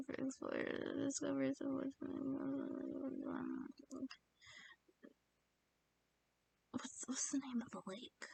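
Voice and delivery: breathy whispered reading to herself. Real, not acted. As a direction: read it under your breath, barely audible. Reading the clue under her breath.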